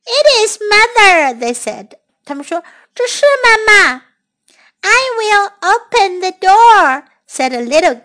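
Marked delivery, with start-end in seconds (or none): 0.1-1.4 put-on excited kids. Kids cheer at the door. 2.9-7.0 put-on excited little kid.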